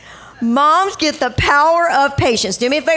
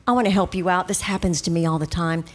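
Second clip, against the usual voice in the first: low, soft reassurance; gentle, confiding tone; kind, discreet offer; 9 semitones lower, 6 dB quieter, faster than usual. Retold or imitated. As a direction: quietly put her at ease. Voices the kind alternative to sarcasm.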